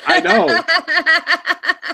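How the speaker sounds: laughing. Real, not acted. Laughing agreement.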